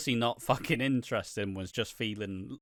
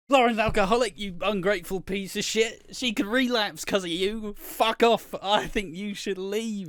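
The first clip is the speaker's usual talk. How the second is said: mock furious outburst; 7 semitones higher than usual. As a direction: throw her out over the dinner. Max yelling at Kirsty at dinner.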